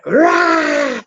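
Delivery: sudden high shouted roar of rage; angry roar. Retold or imitated. Ex smashing lamps in a rage, a high furious roar. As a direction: let out a wild furious roar. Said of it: "And she went".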